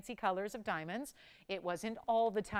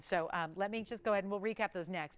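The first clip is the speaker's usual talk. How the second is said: ordinary talk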